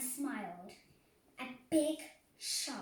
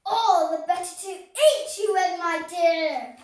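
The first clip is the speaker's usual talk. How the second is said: loud, higher wolf snarl; growled; 6 semitones higher, 17 dB louder than usual. A narrator's reading. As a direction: roar it as the wolf pounces.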